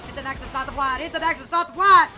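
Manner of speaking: building to a loud shout; rising urgent callout. Real, not acted. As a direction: push the team harder each time. Urging team to end game.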